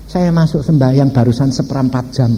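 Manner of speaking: normal talking voice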